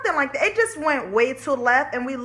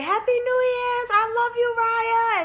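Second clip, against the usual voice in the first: sweet aunt on phone; 10 semitones higher than usual. Retold or imitated.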